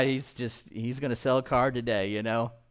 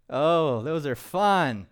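ordinary talk, nothing marked

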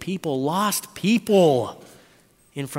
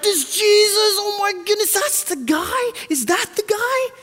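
high, loud starstruck squeal; giddy child voice pitched way up; excited kids; 6 dB louder than usual. Acted. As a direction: squeal it like a kid spotting a hero. Excited kids spotting Jesus.

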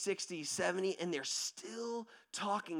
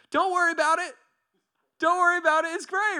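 high, loud, too-cheerful brush-off; overeager reassurance; 10 semitones higher, 8 dB louder, faster than usual. Acted. Driver reassuring wary friend, high overeager insistence. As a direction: insist all is fine, pitched way up. Falsely breezy reassurance before threat.

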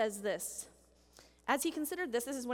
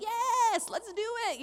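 a high, loud, eager yes; excited teenager shouting yes; 9 semitones higher, 5 dB louder, slower than usual. Retold or imitated. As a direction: jump at it like a keen teen. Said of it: "A teenager's like".

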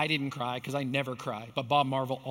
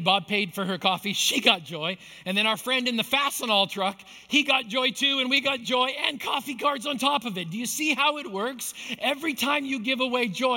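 rising excitement; 7 semitones higher than usual. Acted. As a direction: preach it up to the peak. Preacher builds to climax.

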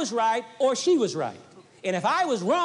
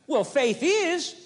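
ordinary talk

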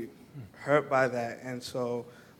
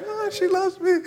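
much higher, teary mock sob; retold bawling; 8 dB louder than usual. He retells bawling after the girl kisses his cheek, high teary voice. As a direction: squeeze it out as if overcome with tears. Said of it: "like, bawling".

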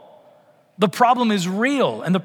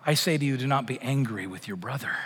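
quiet words of Jesus; 5 semitones lower, 8 dB quieter than usual. Retold or imitated. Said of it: "words from Jesus saying".

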